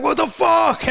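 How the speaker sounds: high, loud outburst; imitating baffled rival. Rival shouting after the car, high and loud. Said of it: "And he was like".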